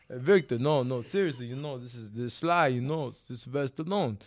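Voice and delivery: halting Rocky-style impression; earnest movie-boxer voice; Sylvester Stallone. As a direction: imitate the action star insisting on his name. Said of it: "Make sure you do his voice".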